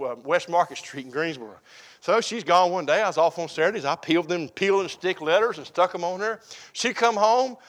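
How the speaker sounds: ordinary talk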